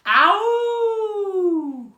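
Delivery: long high doggy howl. Acted. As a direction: howl like a singing dog. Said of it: "He howled and howled".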